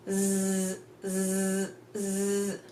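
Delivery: long buzzing "z" with no vowel; letter Z sound. Shown as a demonstration. Teacher showing the sound of Z, held buzz.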